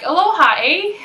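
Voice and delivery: high, lilting Canadian "eh"; Canadian lilt rising on "eh"; mock Canadian greeting. Shown as a demonstration. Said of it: "Very Canadian".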